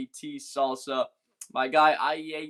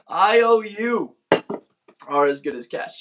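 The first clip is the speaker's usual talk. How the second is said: bursts out laughing, then hits the line loud; laughing punchline; 4 semitones higher, 9 dB louder than usual. Real, not acted. Laughing at his own joke to the stream, loud.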